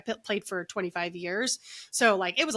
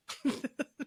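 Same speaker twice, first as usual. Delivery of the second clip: short laugh; 6 semitones higher, 5 dB quieter than usual. Real, not acted. Reacting to her healing story.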